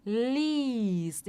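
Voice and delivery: liest with a long i and no e sound; liest, long clear ee. Shown as a demonstration. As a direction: stretch the i in liest. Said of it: "essa vogal é mais longa".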